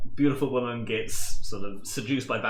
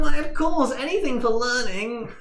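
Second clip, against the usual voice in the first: much higher eager nerd; delighted to help learning; high nerdy voice; 11 semitones higher, 5 dB louder than usual. Acted. Milton grants access cheerfully.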